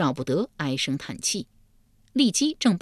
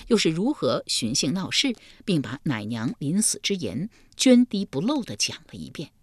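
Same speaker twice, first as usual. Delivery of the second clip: usual speaking voice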